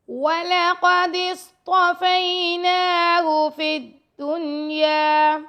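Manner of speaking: slow, melodic tajweed chant; Quran recitation. Shown as a demonstration. Teacher rereads the whole verse in melodic recitation. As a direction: recite the verse slowly, like a qari.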